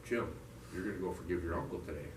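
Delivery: low, soft, matter-of-fact command; voice in his head. Retold or imitated. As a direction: quietly hand down an order. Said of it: "And I hear this voice".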